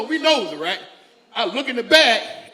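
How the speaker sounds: normal talking voice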